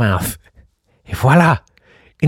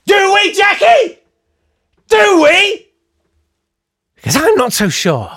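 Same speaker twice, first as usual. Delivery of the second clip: much higher and louder, slow, hammed-up doubt; mock incredulous; 4 dB louder than usual. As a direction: challenge her in a shrill mock outrage. Mock doubting a listener's claim.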